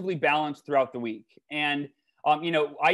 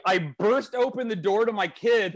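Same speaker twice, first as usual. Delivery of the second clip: ordinary talk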